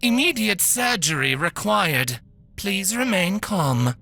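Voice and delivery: a calm machine before surgery; calm robot voice. Acted.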